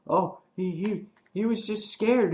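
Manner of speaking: pleading Olivia. Retold or imitated. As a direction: beg them to back off him. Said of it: "Olivia's like".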